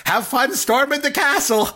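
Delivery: a cheery, hammed-up send-off to the heroes; Miracle Max impression. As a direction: chirp it out like the old miracle man.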